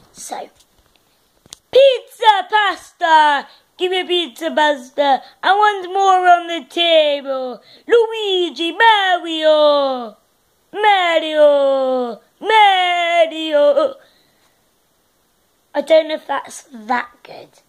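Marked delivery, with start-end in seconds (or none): 1.7-7.6 loud hammed-up Italian accent; mock Italian shouting for pizza. Kid doing an Italian accent for a vlog, loud and hammy. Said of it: "let's do Italy". 7.9-13.9 hammy Italian calling Mario and Luigi; Italian accent. Retold or imitated. Kid calling Mario in a mock Italian accent, loud. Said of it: "let's do Italy".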